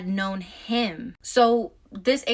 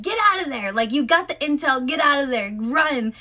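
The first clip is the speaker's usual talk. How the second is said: much higher and louder frantic command; mock-frantic handler shouting run; urgent yell; 5 semitones higher, 5 dB louder than usual. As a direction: shout the order to flee. Rostova yelling in his ear.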